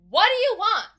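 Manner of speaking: loud, high-pitched yell at the door; yelled sharply as the door flies open; brusque mother. Acted. Woman answering the door to strangers, brusque high yell. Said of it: "she goes".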